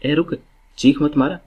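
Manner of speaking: put-on scolding a child. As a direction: shout down a noisy child like a parent. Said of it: "हम उनको डांटते हैं ना इसी प्रकार से".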